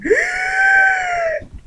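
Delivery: big re-enacted gasp of horror; shocked gasp. She and coworker see the bug, shocked. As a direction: suck in air, high and startled. Startled by motion inside pumpkin.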